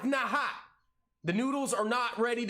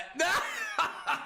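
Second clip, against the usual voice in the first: much higher burst of laughter. Real, not acted. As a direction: crack up at your own pun. Laughing at own dad joke.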